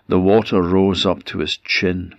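usual speaking voice

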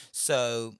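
ordinary delivery

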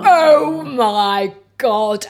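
drawn out, loud and high; amazed. Retold or imitated. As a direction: gasp it out in wonder. Amazed at own bedroom, retold.

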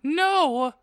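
high, drawn-out mock-frightened cry; theatrical high-pitched scared "no"; scared shout. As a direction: cry out in hammed-up fear. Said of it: "I shouted in a scared voice".

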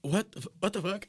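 high-pitched, stumbling disbelief; shocked disbelief. Retold or imitated. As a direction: re-enact being stunned by the news. Retold shock at view count.

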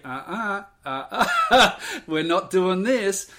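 high, laughing uh-uh no; playful refusal. Retold or imitated. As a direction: wag a finger with your voice. Wife wagging finger, refusing.

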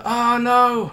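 high, drawn-out oh no; mock dismay. Child realising he forgot teacher's present, mock dismay. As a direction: play up a child's sudden panic.

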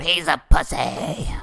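drawn-out mocking crowd shout; smoker heckler. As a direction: imitate a woman heckler, stretching the words out. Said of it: "That's exactly how she sounded. Probably a smoker".

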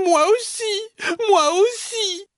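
very high squeaky cry; tiny baby bear. Acted. Baby bear joining in about the bed, tiny cry. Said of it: "crie petit ours de sa toute petite voix".

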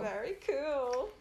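slow, stretched-out playful echo; drawn-out approval. Real, not acted. Podcast host echoing the co-host playfully, drawn-out. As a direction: stretch out the word "cool".

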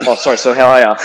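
loud, sudden mock-polite turnaround; imitating an embarrassed about-face; sheepish backpedalling. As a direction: blurt a hasty apology and switch to friendly. Retold caller realising his mistake.

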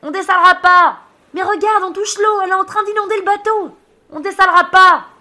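high, loud shouted rebuttal; yelled back. Acted. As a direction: shout down the worry over the din. Friend yelling back on tipping boat.

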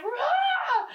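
high-pitched "rah" roar; playful roar thrown up high, amused; mock roar. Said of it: "And he's like".

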